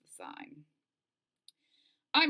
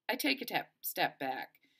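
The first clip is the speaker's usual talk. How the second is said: usual speaking voice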